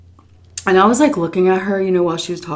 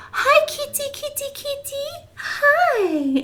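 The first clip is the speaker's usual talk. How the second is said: very high cooing call; baby talk to cats; slower than usual. Retold or imitated. Old woman calling the kittens, very high cooing. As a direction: coo like a delighted child.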